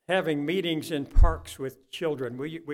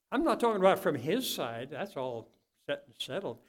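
normal talking voice

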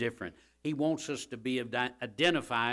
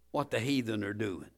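normal talking voice

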